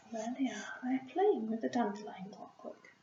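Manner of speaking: hushed whisper pointing at the picture. Real, not acted. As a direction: whisper as if not to disturb the mice. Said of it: "whispered aside about illustration".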